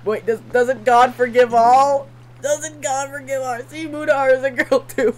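real helpless laughter breaking up the words; laughing hard. Real, not acted. Gamer cracking up over washing Mutahar, laughing hard. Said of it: "I am laughing my ass off".

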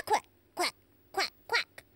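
short, separated quacks; duck quacks. Shown as a demonstration. Host offers a sound for the quiz, crisp duck quacks. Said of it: "Which of these sounds goes with a cat".

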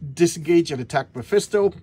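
ordinary delivery

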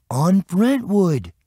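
put-on high, drawn-out kid voice; high-pitched young boy; boy's answer.

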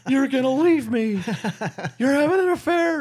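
very high, loud comic wail; mock bawling. Wife accusing her husband of an affair, mock bawling. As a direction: wail it out like a hammed-up sobbing wife.

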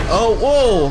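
loud, high startled yelp. Real, not acted. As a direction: jump with a loud burst of fright. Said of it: "that kinda scared me a little bit".

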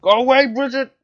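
irritated gamer yell; annoyed shout. Real, not acted. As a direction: yell to shoo someone off. Shooing opponent in game.

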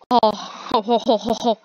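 a triumphant ho-ho-ho chuckle; gleeful ho ho. Real, not acted. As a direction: chortle like you finally won. Finally got into the game.

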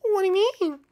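ordinary talk

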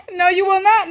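loud, high "no"; firm shout. Real, not acted. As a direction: stop the dog in its tracks. Stopping the dog.

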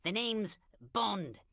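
put-on a weak, slow British accent, barely changed; British accent done badly and slowly; terrible British accent. A lightly put-on British accent, [name] playing a spy hero. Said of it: "that's a terrible British accent".

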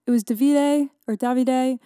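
Davide said two ways, unsure which is right; unsure between two ways of saying Davide; two pronunciations of Davide. Shown as a demonstration. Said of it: "whatever the fuck you, way you wanna pronounce it".

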